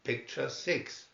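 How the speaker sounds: plain reading voice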